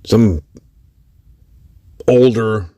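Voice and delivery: usual speaking voice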